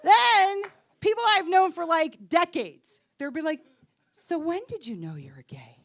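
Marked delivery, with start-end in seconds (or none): 4.1-5.8 low, soft, half-whispered question; hushed asking. Retold or imitated. Old friends asking when she knew, mock hushed voice. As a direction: imitate a confidential, delicate inquiry.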